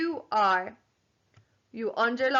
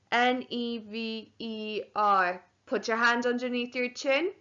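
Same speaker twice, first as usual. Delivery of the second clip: usual speaking voice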